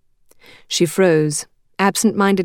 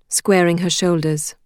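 usual speaking voice

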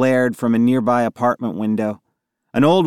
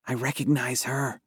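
hushed, much softer voice; whispered; 9 dB quieter than usual. A narrator's reading. Allie confiding in her friends, whispered. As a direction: drop the volume to a confiding whisper. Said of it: "Allie whispered".

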